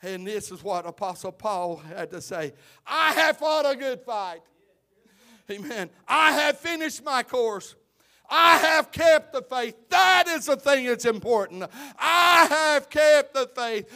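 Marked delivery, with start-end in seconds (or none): 2.9-13.9 loud, high preacher's cry, slowing on the faith; preaching at full force. Acted. Preacher to the congregation, quoting Paul in a loud, high cry. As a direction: pound out each line from the pulpit.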